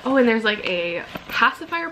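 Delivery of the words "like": ordinary talk, nothing marked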